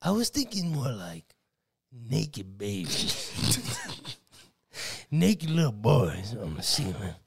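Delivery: a lewd priest leering; creepy priest voice. Retold or imitated. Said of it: "Why did your voice change like that?".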